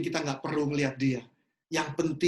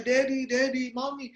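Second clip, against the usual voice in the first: put-on child calling out; 6 semitones higher than usual. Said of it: "dia teriak, dia panggil-panggil papanya".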